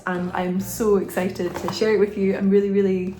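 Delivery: usual speaking voice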